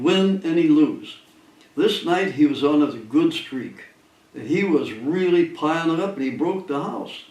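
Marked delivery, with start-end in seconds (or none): none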